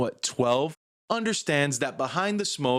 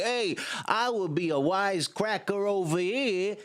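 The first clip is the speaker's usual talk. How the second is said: higher gangster voice; mock gangster; 7 semitones higher than usual. Retelling the ride, she plays the mobster, in a higher mock gangster voice. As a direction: put on a wisecracking mobster. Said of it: "he's like".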